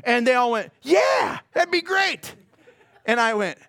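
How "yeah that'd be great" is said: eager yeah. Retold or imitated. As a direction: jump at the offer. Gangsters eagerly accept the job.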